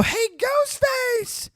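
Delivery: very high, slow, drawn-out sing-song call; mock call to Ghostface. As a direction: sing out his name like a clueless victim. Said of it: "and they go".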